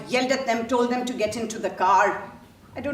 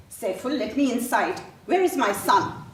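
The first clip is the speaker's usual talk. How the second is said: raised, urgent demand; urgent alarm; 5 semitones higher, 5 dB louder than usual. Acted. Mother to the brother blocking the door, urgently alarmed. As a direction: push past him with a higher, louder voice.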